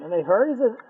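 ordinary talk

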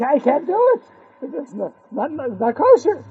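hammy shock and citing sources; mock frum boy. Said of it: "He said".

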